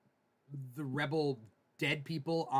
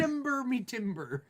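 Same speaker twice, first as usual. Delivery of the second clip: pirate voice; 6 semitones higher than usual. Pretend. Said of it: "It sounds like shimber".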